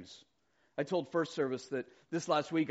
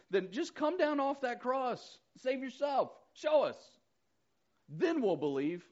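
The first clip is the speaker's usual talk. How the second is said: mocking taunt; 10 semitones higher than usual. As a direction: jeer up at him from below the cross. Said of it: "looking down their nose saying".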